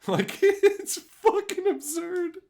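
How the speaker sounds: very high, slow, laughing through. Real, not acted. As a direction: giggle the words out. Laughing at absurd video.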